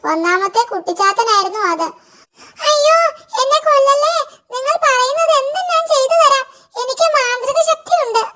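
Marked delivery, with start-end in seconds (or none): 2.7-8.2 terrified imp. Acted. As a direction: wail for your life like a trapped goblin. Imp caught on Ivan's plough.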